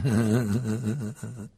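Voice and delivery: short sneering laugh; scornful little laugh. Acted. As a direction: laugh down at them, contemptuous. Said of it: "Il eut un petit rire méprisant".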